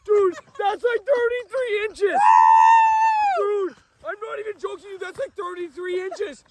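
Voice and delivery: high, giddy cries of amazement; ecstatic disbelief. Real, not acted. Angler sees his giant walleye landed, ecstatic disbelief. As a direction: exclaim it sky-high, stretching the big moments.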